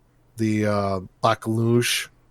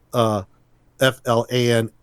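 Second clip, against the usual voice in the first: usual speaking voice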